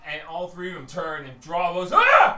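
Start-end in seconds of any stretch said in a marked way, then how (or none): 1.9-2.4 short, very high scream; loud startled orc shriek; startled yell. Acted. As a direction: cry out in sudden alarm. Startled orcs turn and draw bows.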